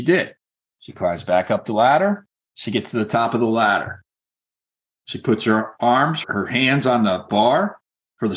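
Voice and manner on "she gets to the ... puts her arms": ordinary delivery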